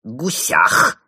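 loud, creepy hoarse prodding; one-word menacing question, croaked loud; insistent villain. A narrator's reading. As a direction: keep needling in a villain's rasp. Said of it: "не успокаивался злодей".

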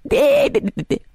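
very high squealed mock bleat; shrieky rapid-fire taunting; teasing bleat. A narrator's reading. Mermaid teasing the chained cat, high bleat. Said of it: "начала дразниться она".

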